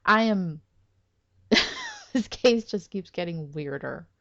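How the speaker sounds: normal talking voice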